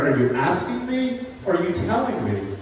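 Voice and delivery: ordinary talk, nothing marked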